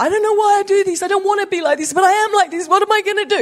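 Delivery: high, imitated cry of despair; desperate pleading. Friend alone in her car, crying out in high despair. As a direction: plead it like someone at the end of her rope. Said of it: "she literally was saying in the car".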